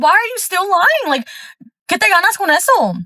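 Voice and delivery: high-pitched retold pleading demand; exasperated confrontation. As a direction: press the liar, pitch held high. Retold confrontation with cheating partner.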